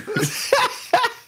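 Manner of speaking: very high squeal of laughter. Real, not acted. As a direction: shriek with laughter. Laughing at own line.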